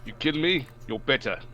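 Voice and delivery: put-on a lightly done, half-South African, half-Australian accent; fake Australian accent. Gamer trying a South African line for friends, half-hearted fake Australian accent. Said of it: "that sounds halfway to fake Australian".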